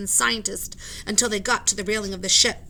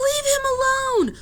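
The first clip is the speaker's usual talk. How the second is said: tearful yell; 7 dB louder than usual. Acted. Annie yells at the sailors, high, loud and tearful. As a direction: yell it through tears. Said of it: "yelled Annie".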